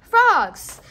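Frogs. said loud and high, falling; clear repeated word. Shown as a demonstration. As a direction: say Frogs. slowly and clearly for a child. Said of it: "Can I say frogs?".

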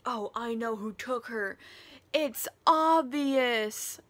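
loud, slowing hammed-up revelation; mock realisation. Reader mimicking Humbert solving the mystery, loud mock realisation. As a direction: build to a big drawn-out mock announcement.

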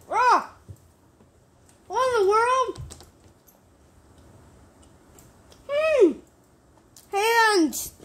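loud, high alarmed gamer yelps; panicking gamer. Real, not acted. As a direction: freak out at the screen. Panicking at game.

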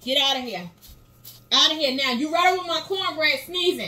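yelling get out, loud and high; angry shout. Real, not acted. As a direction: shoo them out, shouting. Mom shooing sneezer from cornbread.

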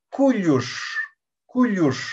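"Cuyush" ending on a curled-back sh; retroflex sh. Shown as a demonstration. Teacher modelling a word, retroflex sh. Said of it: "una retrofleja".

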